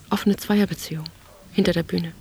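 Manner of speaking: usual speaking voice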